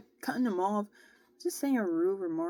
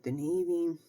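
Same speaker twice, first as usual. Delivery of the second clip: normal talking voice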